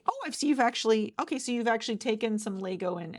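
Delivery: suddenly impressed by what you've found; excited discovery. Acted. Recruiter spots the designer's Lego build, excited.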